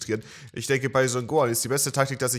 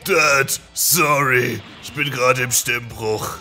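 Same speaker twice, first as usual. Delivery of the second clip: mock Gohan voice; 3 semitones lower than usual. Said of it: "habt ihr Gohan gerade gehört?".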